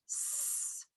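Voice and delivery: long voiceless hiss; S sound. Shown as a demonstration. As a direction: hold a soft "sss" alone. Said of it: "do you hear the S sound".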